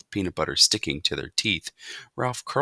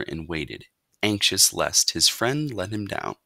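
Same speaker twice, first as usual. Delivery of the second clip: plain reading voice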